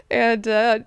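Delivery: ordinary delivery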